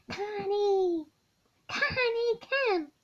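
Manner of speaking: high, loud calling out of a name from another room; mom hollering. Retold or imitated. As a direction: shout the name like her mother calling for help. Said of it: "when she hollers".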